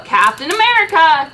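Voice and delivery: loud slow proud shout; loud triumphant shout. Acted. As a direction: bellow your own name. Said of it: "Captain America shouted".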